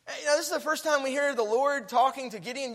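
ordinary talk

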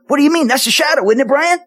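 high-pitched retold disbelief; incredulous questioning. Pastor insists to Brian it is only a shadow, incredulous. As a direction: protest as if it can't be so.

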